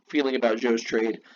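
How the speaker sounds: ordinary talk, nothing marked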